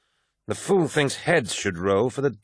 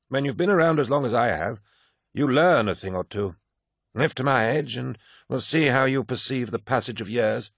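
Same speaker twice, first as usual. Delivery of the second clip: put-on higher, lively old soldier voice; garrulous old warrior holding forth; old veteran Cruz; 5 semitones higher than usual. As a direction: lecture the young man like a proud old-timer.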